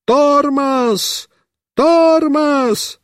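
high repeated urgent call; yelling. Acted. Mishka at the post yells instructions, urgent yelling. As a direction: bawl it out twice. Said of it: "и орёт".

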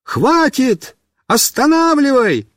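high yell to stop the ride; boy calls out in alarm; shouting. Acted. Boy on the runaway car yells to friend, shouting. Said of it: "и крикнул".

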